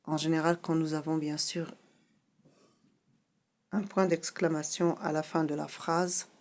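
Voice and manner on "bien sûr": ordinary talk, nothing marked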